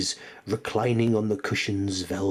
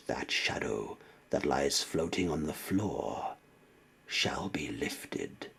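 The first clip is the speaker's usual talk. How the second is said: final hushed whisper; fading whisper; 6 semitones lower, 8 dB quieter than usual. A narrator's reading. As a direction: let the last word die away. Poem's final whispered line.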